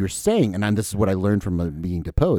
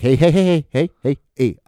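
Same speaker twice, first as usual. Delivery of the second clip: mock placating; 5 semitones higher, 5 dB louder than usual. Acting out calming the punched player.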